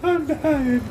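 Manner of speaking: high, thin creature voice; cute dying creature. Pretend. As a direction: play a sweet little creature dying. Voicing the dying water bear.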